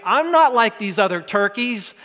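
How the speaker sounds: loud, high, cocky boast; brash loud bragging disciple; bravado. Retold or imitated. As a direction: puff up like Peter swearing loyalty. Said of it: "He's all bravado".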